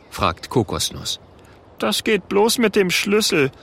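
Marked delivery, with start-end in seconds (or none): 1.8-3.5 grumbling. A narrator's reading. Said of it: "brummt Oskar".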